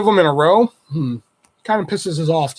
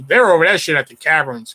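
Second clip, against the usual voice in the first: ordinary talk, nothing marked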